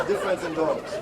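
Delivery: ordinary talk, nothing marked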